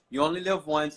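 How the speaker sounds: ordinary delivery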